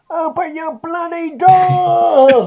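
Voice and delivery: high, loud, slow furious yell; angry shout. Acted. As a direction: bellow through the door in rage. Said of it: "a muffled voice from down the corridor".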